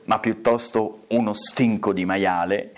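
plain reading voice

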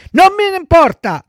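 high, loud bratty retort; defiant child puppet. Acted. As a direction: throw it back like a stubborn kid. Pinocchio defiantly rejects the warnings. (bambino sfrontato, voce acuta)

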